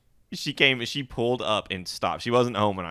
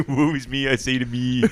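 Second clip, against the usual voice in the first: mock self-pity; 5 semitones higher, 4 dB louder than usual. Friends mocking self-pity.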